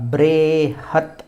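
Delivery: ब्रेहत with a long, held bray; slow ब्रेहत, leaning on the first syllable; Vedic pronunciation. Shown as a demonstration. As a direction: chant ब्रेहत as braaay-hat. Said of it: "इसको ब्रे पढ़ते हैं वेद में".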